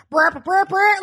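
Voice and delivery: brr brr trumpet noise, rising; buzzing lips like a horn; imitated trumpet. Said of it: "the dad's like".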